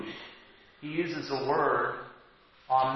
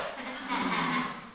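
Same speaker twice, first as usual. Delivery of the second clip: breathy chuckle; 5 semitones higher, 4 dB quieter than usual. Real, not acted. Laughs at his own aside.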